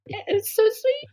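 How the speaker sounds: high, melting with tenderness; touched gush. Real, not acted. To her cohost about the pizza story, melting. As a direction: swoon over how sweet it is.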